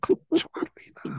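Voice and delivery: hushed, amused comment; whispered aside. Real, not acted. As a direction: whisper it like a secret joke. Whispered aside about the blunder. (ひそひそ声で)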